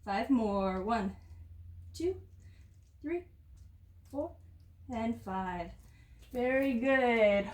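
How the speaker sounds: winded, breathing hard between words. Real, not acted. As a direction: talk while still catching your breath. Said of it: "Ooh, I'm a little out of breath here".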